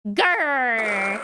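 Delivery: low rolled tiger growl. Acted. Acting out the tiger's second growl, low. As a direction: rumble it out like a big cat. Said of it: "[name] making tiger noises".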